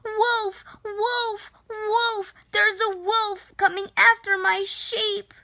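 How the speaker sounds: high, urgent cry of wolf; yelling for help. Acted. Shepherd boy yelling to the town for help, high and urgent. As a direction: shout it like a boy raising the alarm. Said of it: "ran all the way into town yelling".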